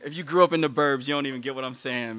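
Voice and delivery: ordinary talk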